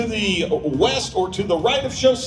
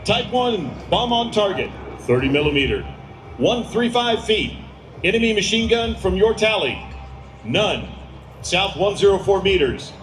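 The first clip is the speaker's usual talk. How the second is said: urgent, higher radio calls; military radio controller; 6 semitones higher than usual. Acted. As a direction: bark out the grid like a JTAC. Announcer voices ground controller.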